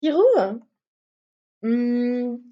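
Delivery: ordinary talk, nothing marked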